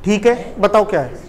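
ordinary talk

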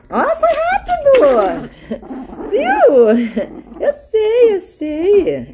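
high cooing praise. Real, not acted. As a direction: coo gently to the dog. Praising the dog.

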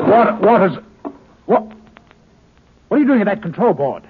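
loud, fast, startled outburst; shocked shout at the man at the controls; alarmed shout. Acted. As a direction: yell in shock at the intruder. Startled, sees stranger at controls.

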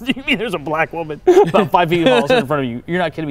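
incredulous with laughter; amazed laughing question; laughing disbelief. Real, not acted.

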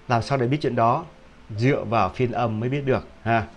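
usual speaking voice

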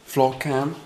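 ordinary talk

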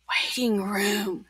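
slow hushed whisper; spooky whisper. Acted. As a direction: whisper it like a horror tale. Patrick makes it sound scary.

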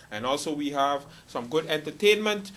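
ordinary talk

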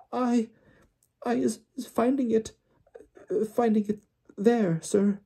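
very high, squeaky timid elf voice; a scared servant stammering; frightened house-elf. Acted. Winky answering Mr Weasley, timid squeaky elf. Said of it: "she whispered".

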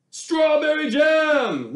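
high, loud, slowed-down exclamation; excited cry. A narrator's reading. Baker leaping up with his idea, excited loud cry. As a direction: burst out with the bright idea. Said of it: "leaped to his feet and cried".

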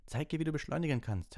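high, loud shout across the pitch; called out to Harry. A narrator's reading. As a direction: yell encouragement mid-flight. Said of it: "rief Red".

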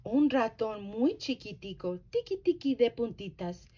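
plain reading voice

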